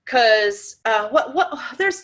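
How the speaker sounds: ordinary talk, nothing marked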